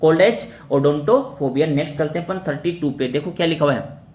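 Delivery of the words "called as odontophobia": definition read out slowly; slow dictation. Shown as a demonstration. Teacher to students, dictating the definition slowly. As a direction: give them time to write it down. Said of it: "ओडोंटोफोबिया।".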